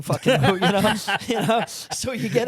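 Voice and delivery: words broken by laughter; laughing. Real, not acted. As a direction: talk through a fit of laughing. Both cracking up at the joke.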